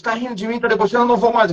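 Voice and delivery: put-on offended girlfriend. Quoting his girlfriend who thinks he mocks her, lightly offended.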